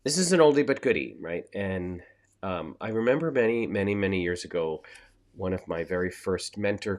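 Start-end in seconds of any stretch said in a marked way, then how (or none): 0.0-1.2 loud, fast and high; emphatic. Real, not acted. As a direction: throw it out with energy. Repeats saying with emphasis.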